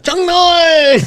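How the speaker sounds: very high, goofy yelp; high-pitched mock holler through a laugh; "What's up" parody. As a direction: squeal it like a silly catchphrase. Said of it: "It's kinda like, "What's up".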